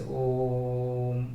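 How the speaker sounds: ओम with the O held very long; long sustained ओम; drawn-out Om. Shown as a demonstration. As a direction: stretch the vowel of ओम slowly. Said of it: "थोड़ा ज्यादा देर तक खींचते हैं".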